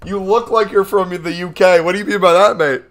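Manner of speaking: loud, high mock British indignation; mock British outrage. Streamer mocking a chat comment, loud mock British outrage. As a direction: bellow it like an offended Brit.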